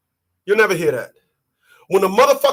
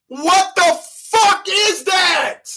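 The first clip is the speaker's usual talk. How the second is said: drawn-out high shout; 8 semitones higher, much slower than usual. Acted. Comic ranting at her math, drawn-out high shout. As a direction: howl the question slowly.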